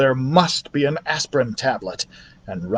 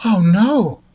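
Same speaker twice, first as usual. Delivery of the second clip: high, louder dismayed cry; dismayed exclamation; 8 semitones higher, 4 dB louder than usual. A narrator's reading. Mother hearing there is no aspirin, dismayed cry. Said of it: "exclaimed the mother".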